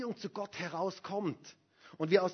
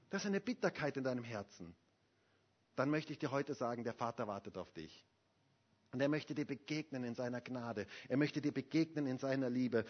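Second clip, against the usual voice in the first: softer, lower, inviting; gentle pastoral invitation; tender; 4 semitones lower, 5 dB quieter than usual. Acted. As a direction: soften and draw the listener close. Quiet invitation after loud preaching.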